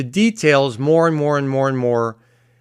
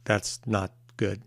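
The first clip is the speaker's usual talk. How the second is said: much softer, dropped low and serious; hushed, earnest; 7 semitones lower, 13 dB quieter than usual. Shown as a demonstration. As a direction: lean in and say it almost under your breath. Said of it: "Let me just tell you".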